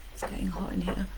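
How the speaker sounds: soft, playful whispered aside; teasing whisper. Pretend. Teasing aside after innuendo.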